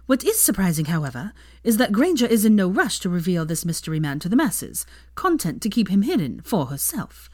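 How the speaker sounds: arch tabloid columnist read; gossip column read. A narrator's reading. Narrator reads Rita's column to the readers, arch and gossipy. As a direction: read it like a smug society columnist.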